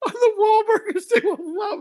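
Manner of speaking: high, squealing through laughter; helpless laughter lifting the voice way up; laughing hard. Real, not acted. Friend jokes about being barred from Wahlburgers, laughing hard.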